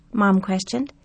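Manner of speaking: ordinary delivery, nothing marked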